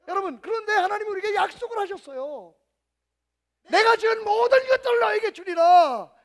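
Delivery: shouted, high, slow and climactic; preaching at full force. Acted. Pastor proclaiming God's promise to the congregation, shouting. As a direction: preach it to the rafters.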